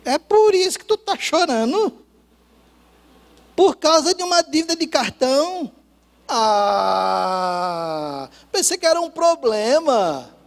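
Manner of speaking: high, loud, breezy brush-off; relieved amused disbelief. Retold or imitated. Husband brushing off his wife's card debt, cheerful and loud. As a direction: laugh off the worry, nothing to fear.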